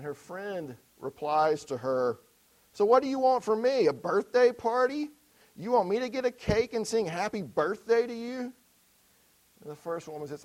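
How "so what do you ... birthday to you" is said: sarcastic retort. Retold or imitated.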